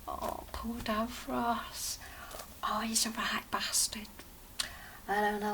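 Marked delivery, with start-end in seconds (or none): 0.1-4.1 soft unvoiced whisper, slow at first; whispered thought. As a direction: breathe it as a private thought. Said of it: "starting to cry and thinking".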